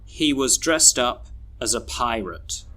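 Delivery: "was", "as", "a" and end of "pirate" reduced to uh; schwa weak forms. Shown as a demonstration. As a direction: say "pirate" as PIE-rut, small words weak. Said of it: "we should read these sentences like this".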